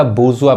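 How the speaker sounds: बुर्जुआ as "boo-zu-aa", no r; correct pronunciation. Shown as a demonstration. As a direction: drop the r in बुर्जुआ. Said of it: "क्या पढ़ाएगा".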